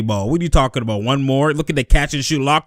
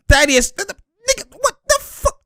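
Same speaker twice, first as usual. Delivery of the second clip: much higher, cracking with disbelief; outraged squeal. Real, not acted. Fan squealing at Thaddeus over a horrendous play, outraged. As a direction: shriek at the player's blunder.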